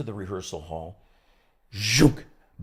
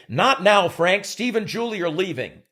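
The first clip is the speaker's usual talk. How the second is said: raised, loud stage line; imitating Bobby's line; 8 semitones higher, 8 dB louder, slower than usual. Actor belting his line to the room, loud. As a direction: belt the line out high. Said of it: "he's supposed to say".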